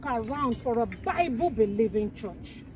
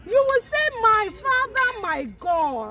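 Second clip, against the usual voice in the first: raised prayer lead; 9 semitones higher than usual. Shown as a demonstration. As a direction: call out the prayer line. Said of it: "you will now repeat after me".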